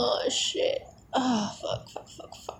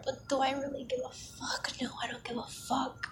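breathy ASMR whisper, close to the mic; intimate whisper; 6 dB quieter than usual. Acted. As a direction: whisper it like a secret in his ear.

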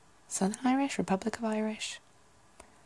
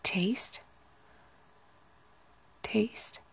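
Southern Irish taste; 2 semitones higher than usual. Shown as a demonstration. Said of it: "Republic of Ireland would be like".

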